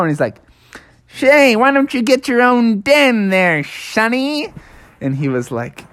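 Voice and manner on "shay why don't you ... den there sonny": high-pitched cartoon groundhog; little critter voice. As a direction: pipe up as a tiny, testy animal. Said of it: "he heard a little voice".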